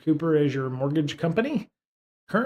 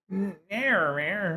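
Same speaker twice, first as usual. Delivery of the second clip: higher mock meows; cat meow; 6 semitones higher than usual. Real, not acted. Imitating the cat.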